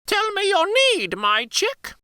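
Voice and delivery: very high, bright magical bird; Firebird voice. Acted.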